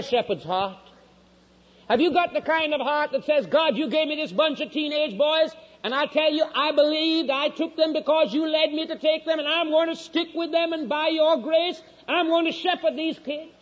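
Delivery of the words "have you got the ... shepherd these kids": high, impassioned vow to God; urgent high-pitched pulpit plea; fervent preaching. Acted. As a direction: pour it out as an earnest pledge.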